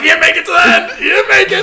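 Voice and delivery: shouting high and loud; shouted jab. Retold or imitated. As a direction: taunt them at full volume. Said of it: "It's like".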